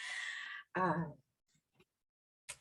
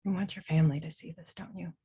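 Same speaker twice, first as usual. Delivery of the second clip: soft reassuring; 10 semitones lower, 7 dB quieter than usual. Acted. Calming someone before a tense moment.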